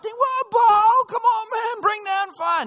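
hammed-up desperate shouting; mock shout. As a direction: yell up at a god who won't answer. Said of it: "So they shout".

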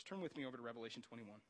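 normal talking voice

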